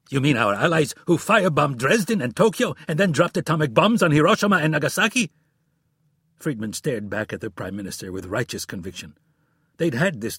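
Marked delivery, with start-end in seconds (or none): 0.1-5.3 angry. A narrator's reading. Sarcastic angry retort.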